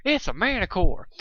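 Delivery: high alarmed cry in a country mare's voice; a lightly voiced Applejack shouting the alarm; Applejack's voice. Shown as a demonstration. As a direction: redo the warning as Applejack would. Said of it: "I did that in Applejack's voice".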